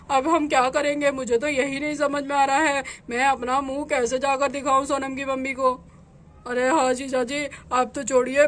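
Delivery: a man dreading his wife's anger; anxious man. Acted. Husband and brother-in-law worry about facing the wife, low anxious male voices.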